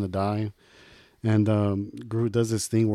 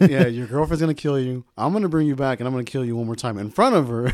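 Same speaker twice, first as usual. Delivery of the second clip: louder, gleeful villain threat; playful villain menace; gloating villain; 3 semitones higher, 6 dB louder than usual. Pretend. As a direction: threaten him with glee. Villain taunting Vision.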